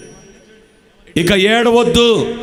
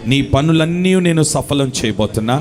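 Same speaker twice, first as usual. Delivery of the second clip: put-on lower, gentle voice of God soothing a crying child; a lightly voiced, tender divine promise; God comforting tenderly; 8 semitones lower than usual. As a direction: speak as a loving father hushing his son. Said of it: "ఈరోజు నీకు మాట ఇచ్చినాడు".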